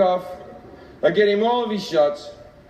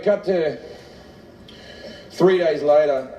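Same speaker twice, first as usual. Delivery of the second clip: ordinary talk, nothing marked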